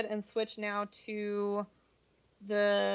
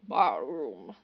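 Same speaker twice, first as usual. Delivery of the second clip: a light mock Treebeard hum, sliding down; playful impression of an Ent sound; imitated Ent rumble; 8 semitones lower, 3 dB louder than usual. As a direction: imitate an Ent's drawn-out sound. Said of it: "when they're imitating the ants".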